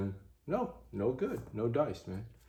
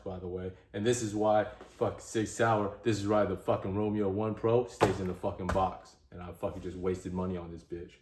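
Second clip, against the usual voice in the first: ordinary talk, nothing marked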